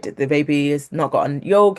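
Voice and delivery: ordinary delivery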